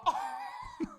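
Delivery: laughing. Real, not acted. Reacts to meaning.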